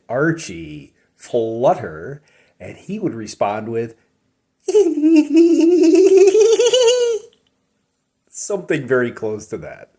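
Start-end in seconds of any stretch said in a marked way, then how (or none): 4.7-7.2 put-on high fluttery boyish giggle, much higher and louder; a flustered teen's giggle, high and bright; loud, high lovestruck giggle; Archie's giggle. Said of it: "he had a little giggle".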